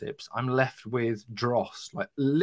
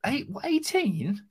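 incredulous; 13 semitones higher than usual. Real, not acted. Incredulous at a teammate's score.

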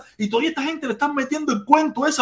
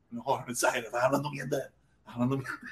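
low, soft mock muttering with a chuckle; dismissive muttered jab; muttered aside; 9 semitones lower, 14 dB quieter than usual. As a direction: mutter it under your breath, half laughing.

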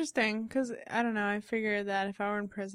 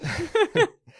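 high laugh; 12 semitones higher, 10 dB louder than usual. Real, not acted. Laughing at own story.

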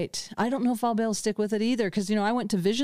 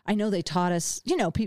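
usual speaking voice

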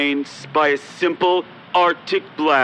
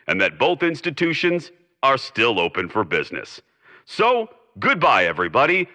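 put-on old-time broadcaster's closing address; formal radio sign-off; 3 semitones higher than usual. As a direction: assure the listeners like a broadcaster. Closing announcer speech.

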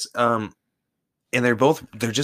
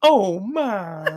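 much higher Southern debutante squeal; one octave higher; 11 semitones higher than usual. Pretend. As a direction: push the Southern belle way up in pitch. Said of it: "like, one octave higher".

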